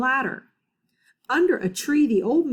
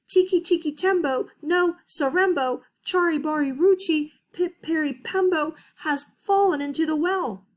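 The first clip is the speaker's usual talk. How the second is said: high and breathless rush; panting; 7 semitones higher than usual. Acted. Chang, out of breath, begging his mother. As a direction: push the long name out on little air. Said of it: "he panted".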